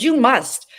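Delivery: firm insistent demand to a mentor; emphatic command. Retold or imitated. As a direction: lay down "You must." as an order, no room to refuse. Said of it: "That's emphatic".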